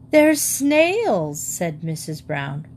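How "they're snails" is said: high, horrified disclosure; aghast, falling from a high start; appalled revelation. Acted. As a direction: break the awful news, pitch raised. Wife reveals the horrid truth.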